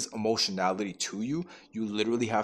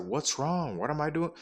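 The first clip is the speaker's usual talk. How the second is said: anxious, higher mock fretting; worried; 6 semitones higher than usual. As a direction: imitate your younger self panicking, pitched up. Anxious boyfriend retelling own worry.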